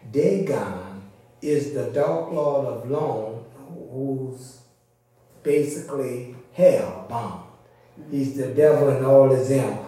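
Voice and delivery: ordinary delivery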